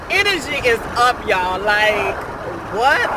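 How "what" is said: normal talking voice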